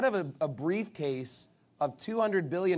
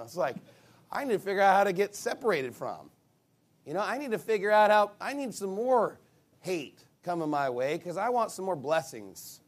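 ordinary talk

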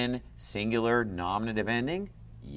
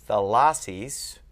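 thalassis slowly, LAS stressed; second-to-last stress; 4 dB louder, slower than usual. Shown as a demonstration. Teacher reciting a declension, middle stress on thalassis. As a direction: lean on the middle syllable of thalassis. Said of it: "second to last".

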